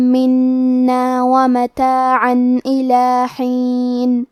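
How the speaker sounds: slow melodic tajweed chant; measured Quranic recitation. Shown as a demonstration. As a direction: recite the verse slowly with held vowels.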